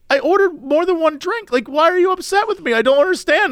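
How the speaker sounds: high-pitched, pleading bewilderment; baffled protest. Retold or imitated. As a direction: plead your case, pitched well up. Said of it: "And I was like".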